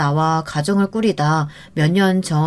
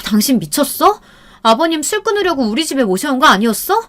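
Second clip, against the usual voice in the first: 높이 올려 따지는 아내; 남편을 몰아세우듯 높게 외치기; 6 semitones higher than usual. Retold or imitated. 술 마신 남편에게 따짐. (술 마신 남편에게 화내며 높은 목소리로 따지기)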